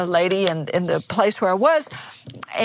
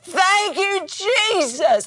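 much higher, exultant mock holler; hollered praise; 9 semitones higher, much slower than usual. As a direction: throw your head back and cry it out. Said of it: "threw back both arms and hollered".

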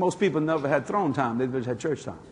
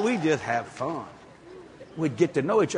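ordinary talk, nothing marked

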